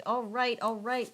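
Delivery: usual speaking voice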